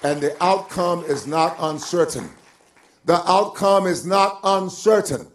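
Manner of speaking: loud, declarative pulpit voice; emphatic preaching. Acted. Preacher assuring the congregation the outcome is settled, emphatic preaching.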